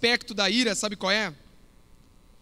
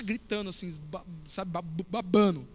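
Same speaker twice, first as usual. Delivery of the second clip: ordinary talk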